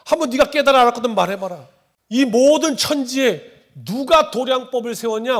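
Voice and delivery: loud, forceful divine challenge; God confronting Job. Acted. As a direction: thunder the question at Job as God. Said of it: "이렇게 말씀하시는 거 갑자기". (욥을 꾸짖는 하나님의 우렁찬 목소리)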